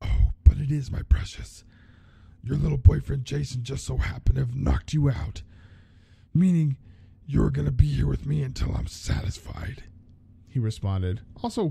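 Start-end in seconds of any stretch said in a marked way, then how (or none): 0.0-9.8 lightly voiced menacing Freddy, breathy in places; creepy villain. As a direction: gloat over the trapped girl. Freddy taunting his captive.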